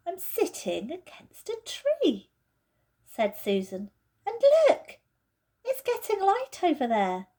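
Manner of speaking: Susan's voice, amazed. Acted. Susan tells the others she sees light, amazed. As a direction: point it out in wonder.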